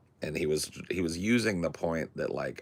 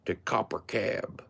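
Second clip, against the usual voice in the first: grandma voice; 6 semitones higher than usual. Retold or imitated. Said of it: "his grandma was like".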